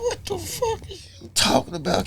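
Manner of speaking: high, hushed incredulous re-enactment; retold disbelief. Husband replaying his answer to wife's accusation, incredulous.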